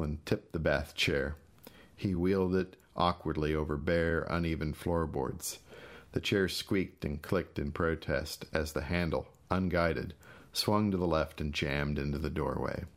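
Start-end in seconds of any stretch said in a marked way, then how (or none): none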